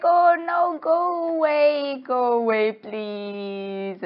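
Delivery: high, drawn-out pleading, fading; mock-terrified plea, falling in pitch; acted panic. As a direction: wail loudly then sink into begging. Acted begging the ghost to leave.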